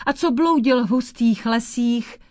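plain reading voice

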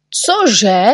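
outraged disbelief; little people cry out in shock; shocked exclamation. A narrator's reading. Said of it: "vykřikli človíčkové".

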